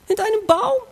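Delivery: high-pitched, incredulous question. Acted. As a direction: squeak it out in disbelief. Ungläubig über Adams Versteck. (ungläubig, mit ganz hoher Stimme)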